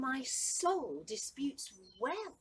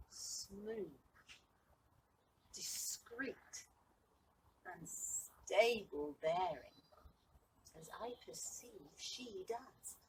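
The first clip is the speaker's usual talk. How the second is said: much softer, measured words with pauses; hushed musing; 4 semitones higher, 7 dB quieter, much slower than usual. Acted. Sebastian considers the lady's bearing alone, hushed and thoughtful. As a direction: drop right down, as if privately weighing each quality.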